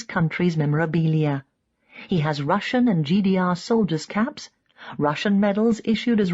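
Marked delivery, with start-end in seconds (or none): none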